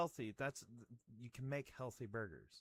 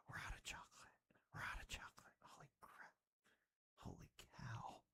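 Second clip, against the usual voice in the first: soft unvoiced dramatic whisper; hushed mock-tragic whisper; mock ASMR whisper; 5 semitones lower, 6 dB quieter than usual. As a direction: whisper the bad news like ASMR. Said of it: "ASMR phase".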